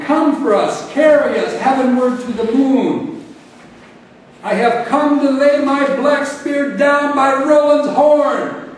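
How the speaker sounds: loud, high, declaimed poetic invocation; ringing, theatrical proclamation at high pitch; grand summons. Acted. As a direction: proclaim it like an epic bard. Poet performs a grand summons.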